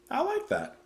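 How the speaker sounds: light up at the idea; delighted. Real, not acted. Delighted reaction again.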